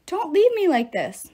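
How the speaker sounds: high, loud plea; pinned king wailing not to be left. A narrator's reading. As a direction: plead out in a wail. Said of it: "Midas wailed".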